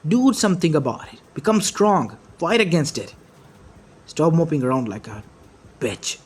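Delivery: fed-up, emphatic pep talk; heated scolding. Acted. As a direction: drive each command home. Naruto angrily urges the child.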